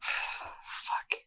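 breathy whisper, almost no voice; whispered curse. Acted. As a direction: whisper it in defeat. Varric sighs at the job.

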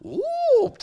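very loud, high comic whoop; mimicked squirting whoop. Preacher imitating the man's squirting tears, high mock whoop.

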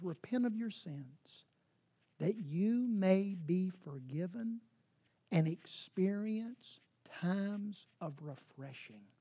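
soft, low, slow reading; hushed scripture. Real, not acted. Preacher reads Acts to the congregation, hushed and slow. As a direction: let the verse settle softly.

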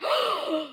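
[name]'s hammed-up sharp intake of breath; mock gasp. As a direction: gasp as if starstruck. [name] hears the name, surprised.

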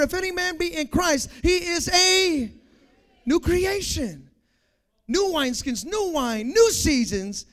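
high-pitched, driving sermon delivery; impassioned preaching. Real, not acted. Preacher promising the congregation a new creation, impassioned preaching. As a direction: preach it urgently, stretching the climactic words.

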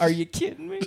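high, laughing disbelief; shocked disbelief. Real, not acted. Listener reacting in shock to the story, high laughing disbelief. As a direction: gasp it through a laugh.

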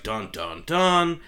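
mock suspense-music "dun dun dun"; spoken soap-opera stinger; dramatic sting. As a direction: voice a reveal sting, rising at the end. Imitates dramatic reveal music.

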